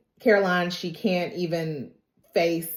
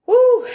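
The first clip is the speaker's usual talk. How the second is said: high, loud exhale of overwhelm; whooped sigh before heavy news; heavy whew; 12 dB louder than usual. Real, not acted. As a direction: let out a big high "whew". Overwhelmed before heavy part of story.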